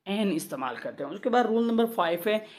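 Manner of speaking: ordinary talk, nothing marked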